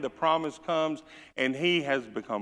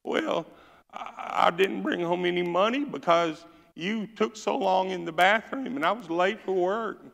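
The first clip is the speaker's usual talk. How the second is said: high, complaining whine; whining daddy; 6 semitones higher than usual. Retold or imitated. Whiner family daddy whines at mama about the bathroom, high pitch. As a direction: blame her in a whine. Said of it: "the daddy whined and said".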